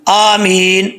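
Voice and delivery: slow, drawn-out chanted recitation; Quranic recitation. Shown as a demonstration. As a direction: recite word by word for the class to follow. Recites Fatiha for students to repeat.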